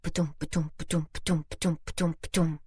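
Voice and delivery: soft, steady rhythmic thumps; imitated heartbeat. Mother recalling the first scan, imitating the heartbeat. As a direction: tap out a tiny pulse with the voice, evenly. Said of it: "where you hear their little heartbeat".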